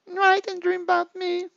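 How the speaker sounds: a high mock-girlfriend repeat. Echoing her boyfriend's goodnight in a high girly voice. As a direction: echo it back in a high, girly voice. Echoes his words in girlfriend voice.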